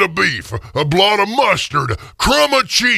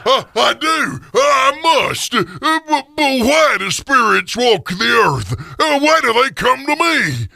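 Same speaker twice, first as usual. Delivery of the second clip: higher, stammering frightened pleading; terrified; 6 semitones higher than usual. Acted. Scrooge on his knees begging the ghost, frightened and high. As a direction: cower and stammer out the questions.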